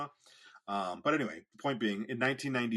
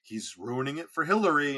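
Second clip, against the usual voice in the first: higher, louder mock whine from the family; family's complaint; 5 semitones higher, 6 dB louder than usual. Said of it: "And they're like".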